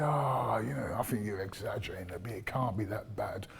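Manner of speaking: imitating a dismissive councillor; mock dismissive official. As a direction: wave their complaints away lightly.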